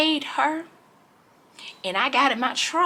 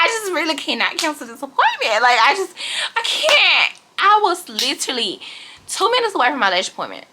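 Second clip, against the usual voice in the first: usual speaking voice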